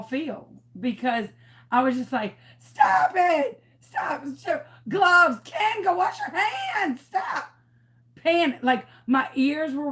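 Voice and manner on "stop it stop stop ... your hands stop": retold shouting. Yelling at Ken on the live video, high and frantic. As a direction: yell at Ken to stop and wash up. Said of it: "when you are shouting at me".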